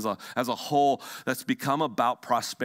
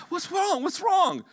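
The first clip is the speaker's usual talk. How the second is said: much higher anxious husband, calling out; 1 alarmed husband; 3 dB louder than usual. Retold or imitated. Pastor acting a startled husband asking his crying wife, high alarmed. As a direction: panic over a crying wife. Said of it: "and he's".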